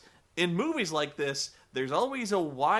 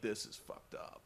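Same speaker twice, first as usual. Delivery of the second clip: whisper; 7 semitones lower, 14 dB quieter than usual. Retold or imitated. Husband sees footage, whispers.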